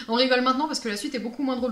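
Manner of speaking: normal talking voice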